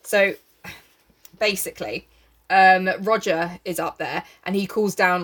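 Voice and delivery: ordinary talk, nothing marked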